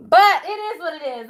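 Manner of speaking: laughing. Real, not acted. Still giggling after fit.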